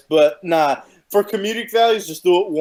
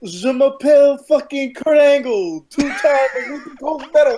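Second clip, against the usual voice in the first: fake French; 8 semitones higher, 4 dB louder than usual. Asked to do fake French, he mimics Kurt in French accent. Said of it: "I wanna hear your fake French".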